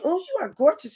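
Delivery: a hint of a gushing flight attendant; gushing older lady. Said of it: "she was like".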